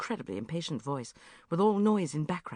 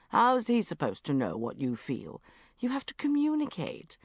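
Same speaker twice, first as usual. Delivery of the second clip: ordinary delivery, nothing marked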